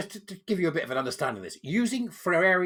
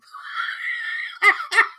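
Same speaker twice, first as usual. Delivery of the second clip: burst of laughter; 6 dB louder than usual. Real, not acted. Laughs at own skit.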